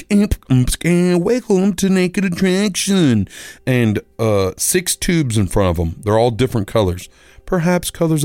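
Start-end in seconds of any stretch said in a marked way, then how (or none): 0.1-3.3 very slow, high showman welcome; TV host voice. Retold or imitated. Host greeting the contestant, slow high showman welcome. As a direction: stretch it like a game show host. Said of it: "here comes the show".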